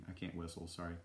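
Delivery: low, soft apology; under-the-breath sorry to the audience; quiet aside. Real, not acted. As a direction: drop down to a quick murmured aside.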